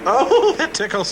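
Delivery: giggling. Acted. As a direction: laugh through the words. Being dressed, giggling.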